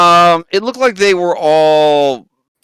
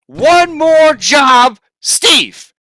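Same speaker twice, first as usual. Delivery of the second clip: very slow, drawn-out shouted mimicry; mocking shout; 13 semitones higher, 7 dB louder, much slower than usual. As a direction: hammer the quote back at her, yelling. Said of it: "You said".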